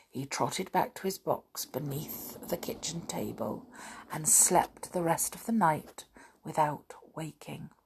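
soft whispered storytelling; hushed narration. A narrator's reading. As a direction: hush it down for sleep. Bedtime narration quieting.

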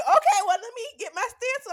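loud, high laughing retort; retold outburst. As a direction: burst out laughing at the boss. Mock reply to rude supervisor.

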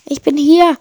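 high, loud answer; calling back. A narrator's reading. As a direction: call back from the left. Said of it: "antwortet sein Freund".

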